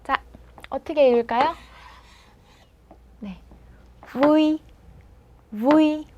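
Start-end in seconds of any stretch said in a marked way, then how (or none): 4.1-6.1 "V" as a slow, drawn-out syllable; teacher's model reading of "V"; demonstrating "V". Shown as a demonstration. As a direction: buzz the lip into a gliding "V". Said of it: "이렇게 읽겠죠".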